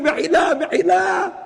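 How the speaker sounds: high, wailing mock plea; acted high-pitched pleading; begging. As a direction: cry out as the begging uncle. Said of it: "he's begging".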